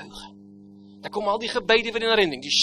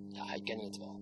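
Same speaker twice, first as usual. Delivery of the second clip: much softer, low repeat; hushed echo; 10 semitones lower, 16 dB quieter than usual. Acted. Preacher echoing himself to congregation, hushed. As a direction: drop to a near hush.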